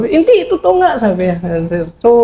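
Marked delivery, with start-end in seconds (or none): 0.0-1.0 insisting. Retold or imitated. Caller insists news is true.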